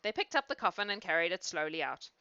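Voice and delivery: ordinary delivery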